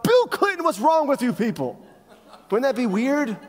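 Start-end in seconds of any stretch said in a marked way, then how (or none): 0.0-1.8 high, loud, comically exasperated outburst; mock angry yell. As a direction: yell it as a swear at annoying people. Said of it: "angry at people and they yell out".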